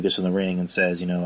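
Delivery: normal talking voice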